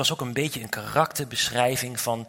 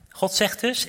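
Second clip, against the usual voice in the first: ordinary talk, nothing marked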